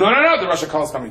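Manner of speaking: rapid, high-pitched acted alarm; urgent warning. Townspeople warning a stranger off, urgent and hurried. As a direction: rush out an imitated warning.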